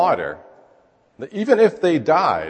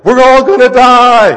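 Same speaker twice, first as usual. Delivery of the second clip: high, loud, slow panicked cry; panicked shout; 13 semitones higher, 14 dB louder, slower than usual. Pretend. Disciples shaking Jesus awake, panicked scream. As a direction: shout it like the boat is sinking. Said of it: "they don't ask nicely at all".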